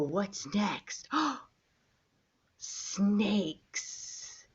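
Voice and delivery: normal talking voice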